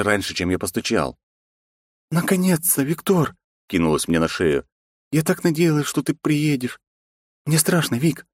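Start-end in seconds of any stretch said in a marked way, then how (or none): 2.1-8.3 put-on frightened young woman. Frightened woman greets hero.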